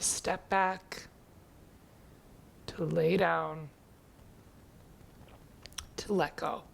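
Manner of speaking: slow, softening meditation voice with long pauses; guided meditation. Acted. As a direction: ease them gradually into stillness, slowly and quietly. Leads a seated check-in.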